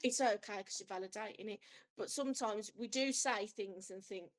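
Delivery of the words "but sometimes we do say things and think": ordinary delivery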